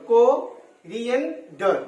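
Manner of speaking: Coriander very slow, in three parts; syllable by syllable. Shown as a demonstration. Teacher showing the three syllables, syllable by syllable. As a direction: break coriander into ko-rien-der, drawn out. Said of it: "তাহলে এখানে তিনটা syllable".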